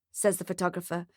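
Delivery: ordinary delivery, nothing marked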